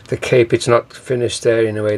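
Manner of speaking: usual speaking voice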